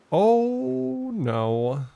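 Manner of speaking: long high drawn-out "Oh", dropping on "no"; high wail of dismay. Real, not acted. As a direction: stretch out a high, sinking-feeling "Oh". Dismay at enemy army landing.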